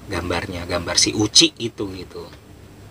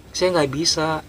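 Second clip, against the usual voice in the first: higher-pitched acted helplessness; retold helpless plea; 7 semitones higher, slower than usual. Awili can't draw the doll, helpless plea. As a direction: plead that it's beyond you.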